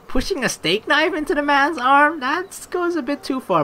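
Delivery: high-pitched disbelief; squeaky-high appalled objection; incredulous protest. Real, not acted. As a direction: object in amused shock, voice way up. Incredulous at the game's violence.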